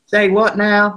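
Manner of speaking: mock Southern twang on "Say what now?"; imitating a Southern accent. Quoting Miss Lee back to the room in a playful Southern accent. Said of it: "She said".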